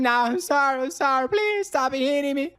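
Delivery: high, whimpering beg for mercy; crying plea. Acted. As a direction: beg through tears to stop the beating.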